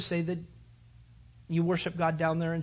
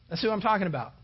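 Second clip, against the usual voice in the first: ordinary talk, nothing marked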